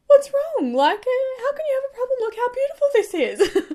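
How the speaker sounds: very high, loud, squeaky mock panic; hammed-up, high-pitched distressed disbelief; retold freak-out. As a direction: plead in an exaggerated squeak, near laughing. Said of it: "like, low-key freaking out".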